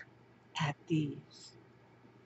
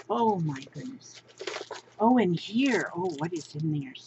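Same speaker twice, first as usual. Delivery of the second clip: normal talking voice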